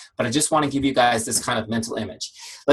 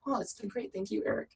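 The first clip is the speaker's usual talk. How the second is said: very high, soft little voice; grateful customer; 9 semitones higher, 12 dB quieter than usual. Acted. Customer Denise thanking her coach for the praise, grateful. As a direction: answer sweetly, small and high-pitched.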